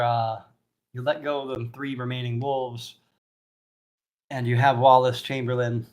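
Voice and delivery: ordinary talk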